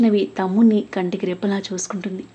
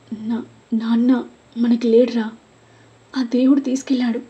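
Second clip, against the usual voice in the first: higher, halting words through a tight throat; choked with grief; 3 semitones higher than usual. A narrator's reading. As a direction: voice breaking as she gives the news of father's death. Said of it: "దుఃఖంతో గొంతు పూడుకుపోయింది". (దుఃఖంతో పూడుకుపోయిన గొంతు)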